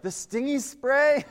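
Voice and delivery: put-on high, slow little-kid question; drawn-out, high-pitched child's plea; worried child. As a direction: imitate a kid dreading the sting. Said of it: "they would always be like".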